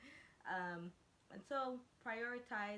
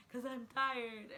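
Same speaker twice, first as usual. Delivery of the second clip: slow, higher, louder worn-out whine; tired whine; 4 semitones higher, 7 dB louder, much slower than usual. Retold or imitated. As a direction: drag it out like you're at the end of your rope. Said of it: "I'm just like".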